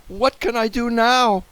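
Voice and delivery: high, anxious wail; worried. A narrator's reading. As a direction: cry out helplessly, high-pitched. Boy narrator in a fix.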